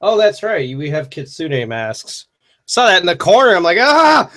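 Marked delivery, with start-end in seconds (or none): 3.8-4.3 high, loud "ah" of fright; sudden high-pitched cry, played up; mock startled scream. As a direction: re-enact a jump-scare yelp. Retelling startle at masks in corner.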